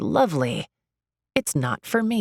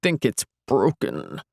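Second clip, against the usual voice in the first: muffled voice; 4 semitones lower than usual. Acted. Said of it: "his voice is muffled from the swelling".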